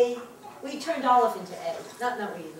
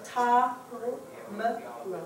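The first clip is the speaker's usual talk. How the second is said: "Ta, m, ro" in separate syllables, long pause after each; slow motion; 4 dB quieter than usual. Shown as a demonstration. Teacher showing the class how two schwas sound, in slow motion. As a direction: break it into "Ta", "m", "ro", one piece at a time. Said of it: "I'm gonna do it in slow motion".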